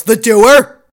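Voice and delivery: a hint of Cragen's disbelief; mock Cragen. Quoting Cragen's shocked question, mild mock disbelief. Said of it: "and Cragen goes".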